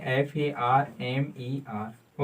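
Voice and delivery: slow soft letter-by-letter spelling; spelled out. Shown as a demonstration. Teacher spelling a word slowly for students. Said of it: "spelling out for students".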